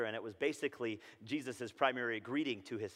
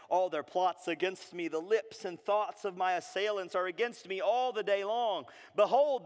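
raised, declaimed lament; impassioned scripture reading; 6 semitones higher, 6 dB louder than usual. Acted. Preacher reading Jeremiah's complaint to the Lord, impassioned declamation. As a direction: proclaim it, building louder toward the end.

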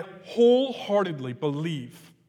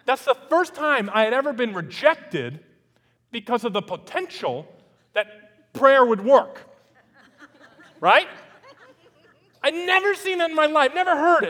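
higher, louder astonished telling; pitched-up excited disbelief; amazed; 9 semitones higher, 7 dB louder than usual. Real, not acted. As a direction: marvel at it.

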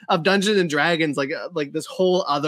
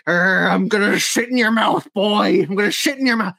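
higher, growled pirate threat; yelling gnome pirate; 5 semitones higher, 4 dB louder than usual. Retold or imitated. Little gnome pirate threatening Lou's character, yelling. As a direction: yell it like an angry little pirate. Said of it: "like, yelling at him".

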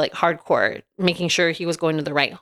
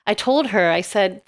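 ordinary talk

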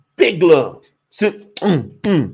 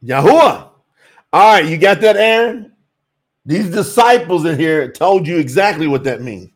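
normal talking voice

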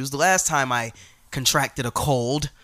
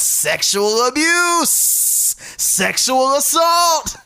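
mock outrage; 10 semitones higher, 5 dB louder, much slower than usual.

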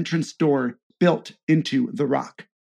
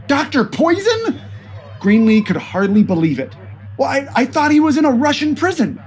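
shocked nervous agent; 9 semitones higher than usual. Acted. Greenlee shocked by news.

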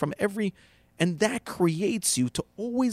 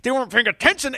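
mock indignation; 8 semitones higher, 5 dB louder, faster than usual. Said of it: "you start telling yourself".

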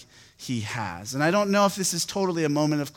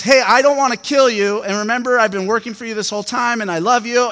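louder, higher retold call; acted insistence, voice raised; imagined earnest appeal; 7 semitones higher, 9 dB louder than usual. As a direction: call out a mock heartfelt protest. Voices David's earnest appeal to Saul.

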